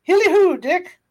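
high, merry holler; rousing high-pitched call; hearty cry. A narrator's reading. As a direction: call out jovially to an apprentice. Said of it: "cried the old Fezziwig".

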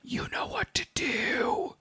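hushed, slow secret whisper. Retold or imitated. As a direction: breathe it like a secret. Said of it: "go up to his mother and go".

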